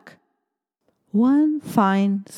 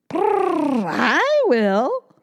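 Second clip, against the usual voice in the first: high, loud purring cat; 10 semitones higher, 4 dB louder, much slower than usual. Acted. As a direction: purr then agree eagerly. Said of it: "purred the cat".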